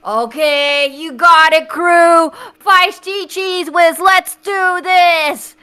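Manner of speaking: loud, rallying battle cry; loud gung-ho soldier. Acted. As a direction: pump up the crew like a sergeant. Rallying the crew.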